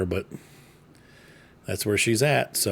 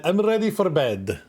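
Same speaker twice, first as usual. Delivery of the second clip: higher, hammed-up mock Italian; put-on Italian accent; 7 semitones higher than usual. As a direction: swoop up into a playful Italian accent. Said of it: "here in Italy".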